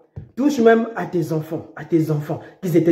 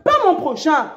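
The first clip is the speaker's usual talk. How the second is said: usual speaking voice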